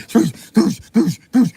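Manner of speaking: loud whooshing effect; loud swoosh imitation; swooshing noises. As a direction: make the boat rush by. Acting out ramming boats.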